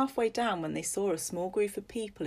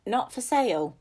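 flat firm refusal, a little louder; firm old woman; 5 semitones higher, 5 dB louder than usual. Acted. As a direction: refuse without budging.

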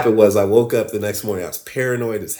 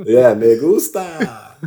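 playful relish; 6 dB louder, much slower than usual. Pretend. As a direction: stretch it out loudly with a laugh. Laughing, playful echo in Spanish.

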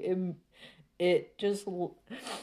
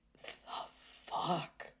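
breathy, voiceless whisper of disbelief; stunned whisper; 9 dB quieter than usual. Retold or imitated. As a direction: whisper it, too shocked for voice. Stunned reaction to the anime.

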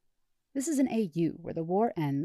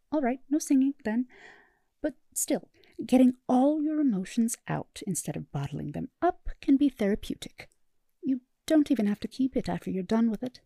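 eager therapist; 7 semitones higher, 5 dB louder than usual. Acted.

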